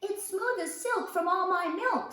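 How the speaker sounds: a lightly voiced proud boy; child's voice. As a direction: say it like a pleased small child. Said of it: "said Peter".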